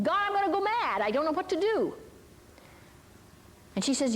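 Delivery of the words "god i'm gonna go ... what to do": high-pitched imitation of someone at wit's end; mock frantic cry. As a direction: retell a desperate outburst, raised pitch but no scream. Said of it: "running and screaming and saying".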